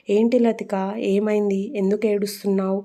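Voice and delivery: ordinary delivery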